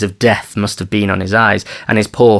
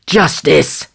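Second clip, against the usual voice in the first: high, impassioned cry for justice; pitched-up, aggrieved outcry; rage and fear; 7 semitones higher than usual. A narrator's reading. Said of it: "his voice trembling with the rage and fear".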